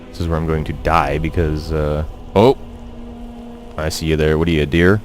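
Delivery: ordinary talk, nothing marked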